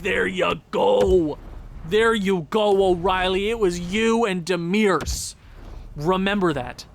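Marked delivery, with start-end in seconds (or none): 0.0-3.5 loud, stretched-out cheer; slow shout. Real, not acted. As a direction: bellow each word slowly.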